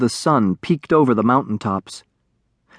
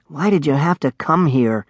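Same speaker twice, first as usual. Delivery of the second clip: ordinary delivery, nothing marked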